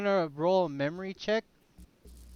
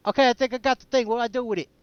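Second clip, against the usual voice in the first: higher, louder, eager report; excited urgency; 6 semitones higher, 7 dB louder, faster than usual. Acted. Player back on the phone with the haunted object, excited. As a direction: blurt it into the phone, keyed up.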